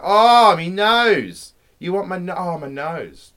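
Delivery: loud, high hammed-up cry of pain; mock pained rhino. The rhino howls at the poacher in hammed-up pain. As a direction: howl about the nose in mock agony.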